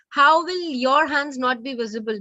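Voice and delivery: ordinary talk, nothing marked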